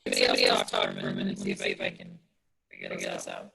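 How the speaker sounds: distorted, buzzing audio through a fan. Real, not acted. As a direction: voice garbled by fan noise. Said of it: "it sounds like you're speaking into a fan".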